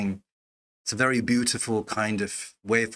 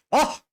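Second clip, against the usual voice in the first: delighted gasp; 4 dB louder than usual. Real, not acted. Moved by the verse.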